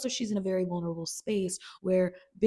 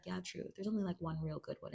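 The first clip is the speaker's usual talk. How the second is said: mumbled; 10 dB quieter than usual. Retold or imitated. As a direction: murmur it offhand. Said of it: "Fez is like".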